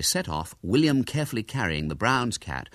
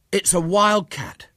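high, loud, slow wonder; amazed; 11 semitones higher, 6 dB louder, slower than usual. Acted. William stares at the huge creature, amazed. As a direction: marvel at it, drawing out the words.

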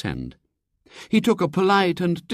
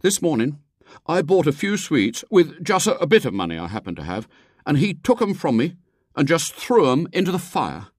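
put-on higher boy's voice, piling on grievances; aggrieved schoolboy; 9 semitones higher than usual. A narrator's reading. William spins his woes for the lady, aggrieved. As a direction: tell a tall tale of woe as a boy.

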